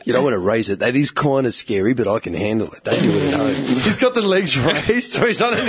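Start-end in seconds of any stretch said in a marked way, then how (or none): 0.1-3.6 low, cocky Aussie gym bloke. Pretend. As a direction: brag like a bogan lifter. Imitating gym bro boasting.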